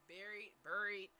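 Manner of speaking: "Buried" said twice carefully, fixing it; practicing a word. Real, not acted. Speaker to viewers, correcting her own stumble, careful "buried". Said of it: "having a hard time saying that word".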